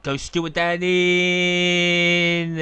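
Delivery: loud, high call with the name stretched very long; drawn-out commentary cry. Real, not acted. Commentator calling Stuart Downing's attempt, long excited shout. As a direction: belt it out and hold the last word. Commentator calls out a player's shot.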